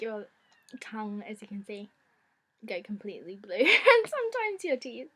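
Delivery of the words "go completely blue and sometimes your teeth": laughing loudly through the words. Real, not acted. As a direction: giggle while you talk. Laughing about her blue tongue.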